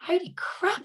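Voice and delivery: softly stunned at her own life; a little private holy crap of wonder; amazed thought. Retold or imitated. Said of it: "sat there and thought".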